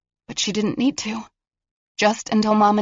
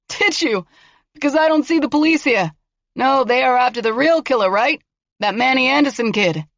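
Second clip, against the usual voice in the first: higher, mocking taunt; taunting killer; 7 semitones higher, 4 dB louder than usual. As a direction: sneer at her bluff. Killer taunting girl through door.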